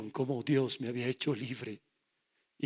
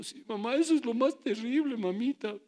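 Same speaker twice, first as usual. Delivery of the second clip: put-on whiny drunk son; 7 semitones higher, 4 dB louder than usual. Drunk son lies to mother.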